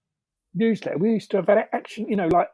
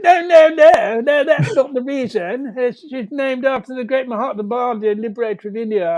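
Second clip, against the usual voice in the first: indignant correction; 7 semitones higher, 5 dB louder than usual. Retold or imitated. Wife correcting the bloke indignantly.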